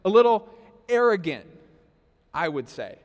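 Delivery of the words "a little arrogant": little punched loud; little thrown out loud, arrogant said firmly; stress on little. Real, not acted. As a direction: hit the word little hard, then land on arrogant. Stressing how arrogant the kid is.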